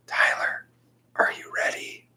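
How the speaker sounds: breathy voiceless whisper from the doorway; hushed ghostly call; eerie whisper. Retold or imitated. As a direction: whisper it like a ghost calling a kid.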